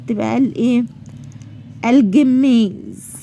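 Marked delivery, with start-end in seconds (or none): 1.9-3.1 الجميز drawn out slowly with a clear L; pronounced lam. Shown as a demonstration. Teacher giving an example of lam qamariya, slow clear L in الجميز. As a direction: sound the L before the g in الجميز. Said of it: "لأن نطقت اللام".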